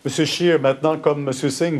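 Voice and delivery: ordinary talk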